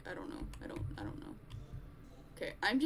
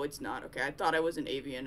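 usual speaking voice

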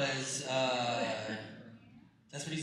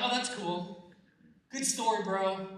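mock casual shrug; 4 semitones higher than usual. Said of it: "It's not like".

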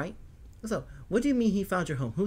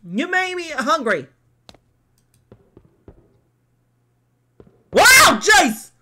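high, loud startled exclaim; surprised outburst; 12 semitones higher, 10 dB louder, much slower than usual. Real, not acted. Reacting to the game's sudden turn, startled. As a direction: blurt it out in shock.

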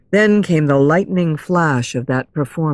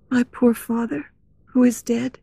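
higher, hushed heroine voice; soft grief; 6 semitones higher, 4 dB quieter than usual. A narrator's reading. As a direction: speak gently of a loss. Christine speaks of dead father.

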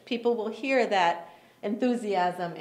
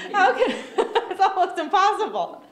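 high laughing protest; 6 semitones higher than usual. Real, not acted. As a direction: laugh through the words.

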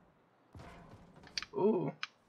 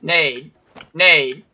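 loud repeated urgent callout; grenade warning yell; 2 semitones higher, 13 dB louder, much slower than usual. Real, not acted.

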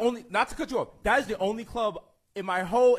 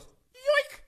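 mock thief yoink. Thief grabbing phone.

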